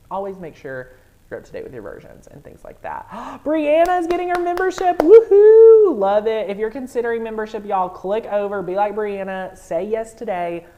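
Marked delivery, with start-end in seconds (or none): 3.4-10.7 gasp, then very loud, high whoop easing into urging; over-excited. Real, not acted. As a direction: whoop for a new member, then cheer everyone on. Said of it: "I'm getting too excited".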